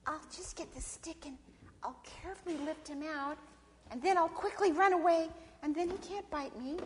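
Sister Possum reasoning. Acted. Possum planning to lift snake out, high careful reasoning. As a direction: talk yourself into it in a high voice. Possum talks herself into helping.

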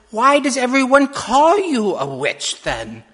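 put-on high, loud child's challenge; questioning little girl. A narrator's reading. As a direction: demand an answer like a puzzled child.

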